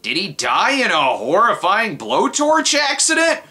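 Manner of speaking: high, exasperated yell; indignant outburst. Acted. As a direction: shout it at the TV in disbelief. Mock outrage at commercial.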